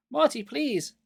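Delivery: high, pleading cry; desperate plea. A narrator's reading. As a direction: beg him to wake. Pleading scream.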